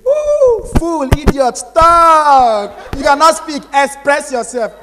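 loud, high mocking scold; imitating rude girls, loud and high. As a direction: bark insults like a snooty girl. Mocking girls who insult you.

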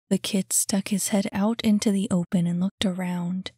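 usual speaking voice

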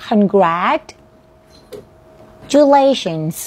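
"congratulations" stretched slowly, broken before "-lations"; drawn-out teaching demo of "congratulations"; pronunciation model. Shown as a demonstration. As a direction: say "congratulations" in slow parts with a long pause midway. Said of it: "ลองเสียงคำนี้ค่ะ".

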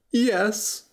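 mildly mournful; sad. A narrator's reading. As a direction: answer with a touch of sorrow. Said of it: "said the prince sadly".

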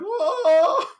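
cartoonish shrieking sob of fright; scared crying. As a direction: voice the terrified people, crying out in fear. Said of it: "because they're so scared".

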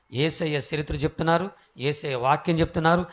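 ordinary talk

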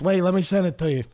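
usual speaking voice

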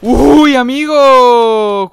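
loud, high, long-held "uy, amigo"; drawn-out exclamation. Real, not acted. Streamer reacting to an aggressive player, drawn-out exclamation. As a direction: stretch the words out loudly.

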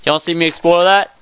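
normal talking voice

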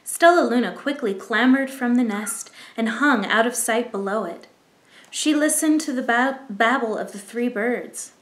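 ordinary delivery

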